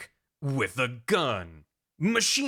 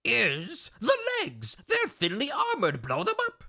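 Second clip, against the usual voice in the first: high, eccentric scientist voice; hammed-up squeaky-pitched professor; Doctor Madnar; 5 semitones higher than usual. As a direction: build to a slow, very high secret reveal. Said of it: "He returns to Doctor Madnar".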